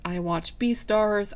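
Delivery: ordinary delivery, nothing marked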